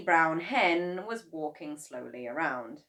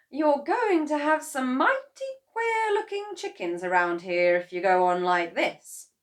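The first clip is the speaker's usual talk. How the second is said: high, loud scolding wife; Mrs Cranky; 8 semitones higher, 9 dB louder than usual. A narrator's reading. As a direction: warn her husband, voice raised high. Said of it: "she said".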